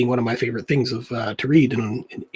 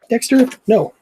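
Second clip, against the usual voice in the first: sharp raised no to the pet; scolding the cat; 10 semitones higher, 5 dB louder than usual. Real, not acted. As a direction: stop the cat mid-step, louder and higher. Scolding his cat off the desk.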